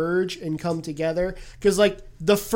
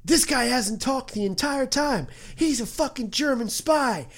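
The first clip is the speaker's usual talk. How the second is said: suspicious soldiers; 6 semitones higher than usual. Retold or imitated. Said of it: "they're like".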